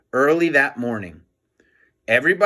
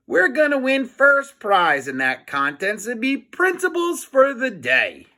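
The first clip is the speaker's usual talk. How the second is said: put-on boastful kid, pitched up; high boy voice; 9 semitones higher than usual. Harold bragging to the principal about winning, high and cocky. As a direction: brag it in a high little boy's voice. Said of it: "said Harold".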